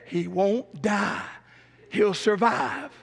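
very slow, deliberate divine voice; slow solemn pronouncement. Retold or imitated. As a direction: draw each word out like a verdict from above. Voicing the Lord's word.

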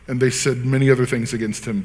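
ordinary talk, nothing marked

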